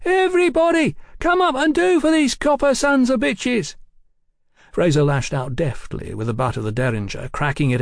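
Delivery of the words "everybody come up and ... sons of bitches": very high street vendor's call; rally the street in a screech. A narrator's reading. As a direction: holler it high like a chestnut seller. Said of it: "he screeched like a man selling hot chestnuts".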